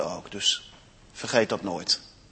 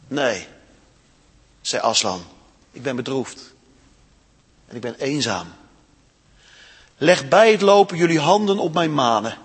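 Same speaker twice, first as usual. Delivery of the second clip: sad lion; 4 semitones lower than usual. Acted. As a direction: speak heavy with sorrow. Aslan sad and lonely.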